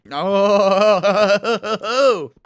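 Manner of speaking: long, loud groaning oh; drawn-out dismay. Real, not acted. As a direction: stretch it out in crushed disappointment. World just crashed, dismay.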